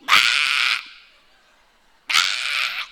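harsh mock tantrum scream; loud rasping child's yell; short bursts of imitated screaming. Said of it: "he's just yelling".

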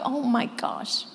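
ordinary talk

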